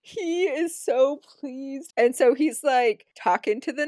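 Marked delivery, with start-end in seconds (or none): 0.0-1.9 slow emphasis. Real, not acted. Stressing how pleased he is.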